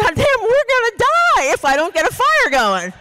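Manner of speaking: high-pitched, hammed-up desperation; retold panic. Retelling her panic over the fire.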